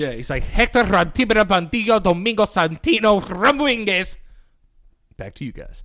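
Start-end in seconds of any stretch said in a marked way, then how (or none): 0.4-4.2 high, loud exaggerated Spanish rolling of the name; mock Spanish accent. Mocking a news anchor saying his own name, exaggerated Spanish accent. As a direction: roll the name like a newscaster going full Spanish. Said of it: "he just says it mad Spanish".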